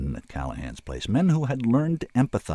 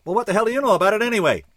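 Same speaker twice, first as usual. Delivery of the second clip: fast, high, louder retort; snapping back; 11 semitones higher, 5 dB louder, much faster than usual. A narrator's reading. Kid thinks he's being mocked, snapping back. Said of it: "snapped back".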